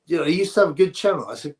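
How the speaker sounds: usual speaking voice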